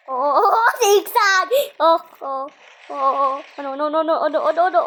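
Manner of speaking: loud frantic oh oh oh; panicked yelling. Real, not acted. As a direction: panic as the monster closes in. Panicked chase in game.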